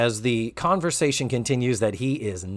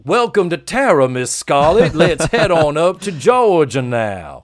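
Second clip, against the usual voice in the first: a hint of an old Southern gentleman; mock Southern accent; 4 dB louder than usual. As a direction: play a genteel plantation host, a bit louder. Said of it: "this foghorn leghorn dialect".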